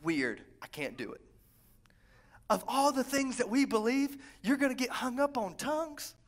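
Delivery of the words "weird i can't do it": mock reluctant refusal. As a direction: drop low and bail out, unimpressed. Acting out the reluctant believer.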